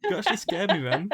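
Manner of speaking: relieved and laughing at the scare; laughing relief. Real, not acted. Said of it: "Did you think that I was actually in trouble".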